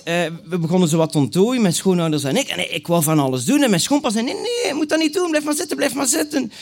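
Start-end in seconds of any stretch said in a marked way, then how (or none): 4.2-6.5 insistent father-in-law. Retold or imitated. Father-in-law refuses help.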